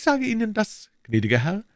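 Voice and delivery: high, anxious servile voice; bow and scrape with every word; nervous, eager innkeeper; fawning innkeeper. A narrator's reading.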